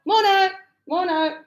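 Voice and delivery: high, loud "Morno" like on a building site; Aussie "morno". Retold or imitated. Teacher imitates builders' morning greeting, loud Aussie. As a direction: greet mates on the worksite. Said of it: "if I go to work in construction".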